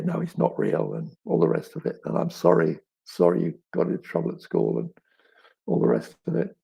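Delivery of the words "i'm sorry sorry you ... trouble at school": usual speaking voice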